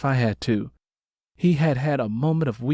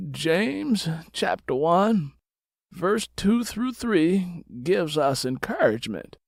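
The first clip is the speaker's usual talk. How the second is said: slow, high pastor reciting scripture; lightly voiced reverend, solemn and measured; preacher father; 6 semitones higher, slower than usual. Reverend father answering his daughter with a Bible verse, slow.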